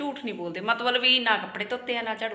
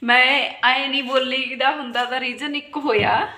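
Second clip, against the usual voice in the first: usual speaking voice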